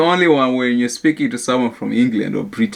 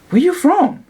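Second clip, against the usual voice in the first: imitated question. Acted exchange with a Russian speaker.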